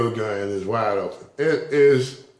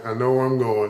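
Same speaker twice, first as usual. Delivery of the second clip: ordinary delivery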